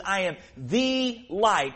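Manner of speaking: "the" leaned on, said slowly; slow "I am THE light", weight on "the"; stress on the. Shown as a demonstration. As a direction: press the word "the" in "I am the light". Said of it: "I want to emphasize".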